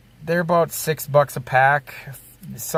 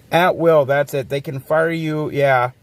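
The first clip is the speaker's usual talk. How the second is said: ordinary talk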